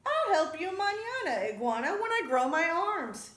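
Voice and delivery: high, loud snake character voice; eager mock offer. A narrator's reading. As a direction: sing out the offer at the top of the voice. Snake Culebra's triumphant mock offer.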